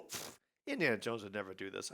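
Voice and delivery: usual speaking voice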